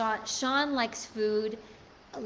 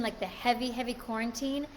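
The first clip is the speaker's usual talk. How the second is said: ordinary talk, nothing marked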